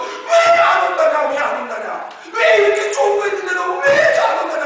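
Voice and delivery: loud, high cry of frustration; worked-up, distressed outcry; anguished impatience. Acted. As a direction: shout it like a pleading, exasperated master. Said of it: "안타까워서".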